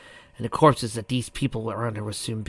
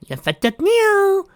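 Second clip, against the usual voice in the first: shrill taunt; 9 dB louder, slower than usual. Acted. Pinky taunting.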